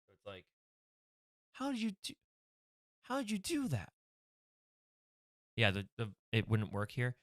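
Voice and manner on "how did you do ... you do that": high, softer puzzled question; baffled officer. Acted. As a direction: ask it high, genuinely puzzled. Cop character question.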